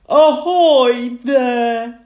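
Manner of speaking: high, loud, drawn-out seafaring hail; Grandpa Pig. A narrator's reading. Grandpa Pig hails the family from his boat, loudly. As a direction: call out slowly like a storybook grandpa. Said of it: "Grandpa Pig said".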